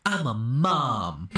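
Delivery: slow deadpan mom; drawn-out mock mom line. Retelling the film, the mom's slow deadpan reply.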